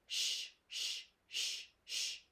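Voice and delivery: drawn-out hushing sh; sh sound. Shown as a demonstration. Teacher sounding out the middle sound of fashion. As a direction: hiss the sh sound slowly, four times. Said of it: "The middle sound of that word".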